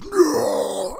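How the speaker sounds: high, sharp cry of No; mock yell. Retelling Braun storming the ring, a high mock yell. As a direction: burst out like the wrestler charging in.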